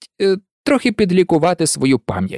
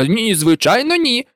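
high denial through a grin; grinning; 6 semitones higher than usual. Acted. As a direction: deny it with an audible grin. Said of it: "вишкірився Джордж". (з широкою усмішкою)

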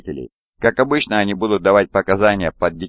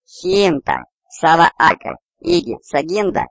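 tiny squeaky fuzzy creature; high little creature voice; 12 semitones higher than usual. A narrator's reading. Little one speaks his own language to Jack, high creature voice. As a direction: pipe strange words very high, like a small creature. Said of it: "тихие высокие звуки".

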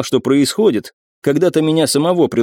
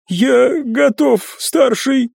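high servant voice; 12 semitones higher, much slower than usual. Acted. Servant declares readiness.

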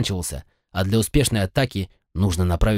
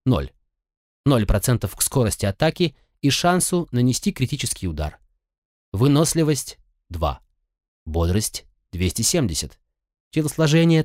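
ordinary delivery

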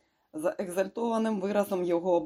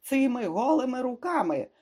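high, raised angry exclamation; bellowed threat; 8 semitones higher, 4 dB louder than usual. A narrator's reading. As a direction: throw it out as a furious threat. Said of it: "гаркнув він". (гнівно, підвищеним голосом)

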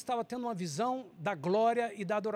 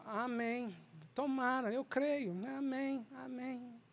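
softer, higher half-hearted assent; half-hearted amen; 4 semitones higher, 7 dB quieter, slower than usual. Acted. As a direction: say amen without conviction. Acts out casual, doubtful amens. (amém sem convicção)

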